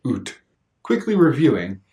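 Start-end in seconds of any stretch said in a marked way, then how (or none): 0.1-0.4 "oot" with a long oo vowel, mock Canadian accent; exaggerated Canadian accent on "oot". Shown as a demonstration. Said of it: "Exaggerated sound".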